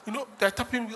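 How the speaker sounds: ordinary talk, nothing marked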